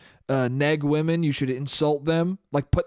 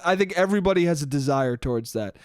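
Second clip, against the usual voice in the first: ordinary delivery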